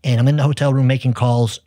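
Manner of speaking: usual speaking voice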